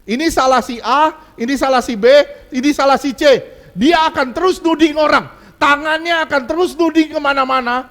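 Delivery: loud, high, driving preacher's cadence; preaching at full force. Acted. Preacher to congregation, loud fervent preaching. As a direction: hammer each accusation at the congregation. Preacher mocking finger-pointing people.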